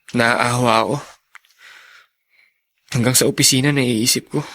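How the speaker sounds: low, subdued, pitying tone; downcast. Acted. Brother pities his sweating, panting father, downcast. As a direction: recount it sadly, voice held down. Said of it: "bakit parang malungkot ka".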